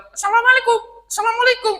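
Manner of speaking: squawky parrot greeting, very high; high chirpy parrot imitation. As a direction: squeak it out like a talking bird. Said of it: "Cuman bilang".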